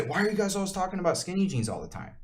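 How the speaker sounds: high, fast, whiny mock question; mocking whine. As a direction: mimic a complaining critic. Mocking critics' imagined complaint.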